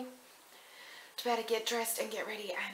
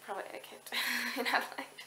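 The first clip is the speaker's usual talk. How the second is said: usual speaking voice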